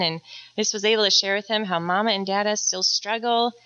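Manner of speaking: usual speaking voice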